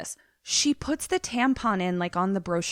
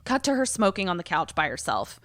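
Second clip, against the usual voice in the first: ordinary talk, nothing marked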